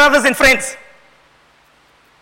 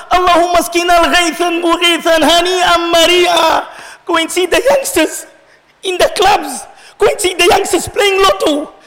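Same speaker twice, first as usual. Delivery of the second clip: high, urgent sermon rebuke; impassioned preaching; 8 semitones higher than usual. Real, not acted. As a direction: drive the lament home to the congregation. Pleads at full force.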